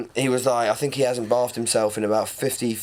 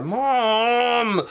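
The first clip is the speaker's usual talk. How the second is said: put-on whiny teen; 12 semitones higher, 3 dB louder than usual. Teen whining at mum.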